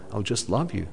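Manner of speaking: normal talking voice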